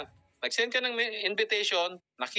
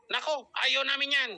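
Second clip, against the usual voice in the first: mock protest, higher and quick; annoyed boyfriend; 7 semitones higher than usual. Boyfriend replying to her invite, mock protest. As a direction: imitate a disapproving boyfriend, pitched up. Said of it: "Yung boyfriend na nag-reply".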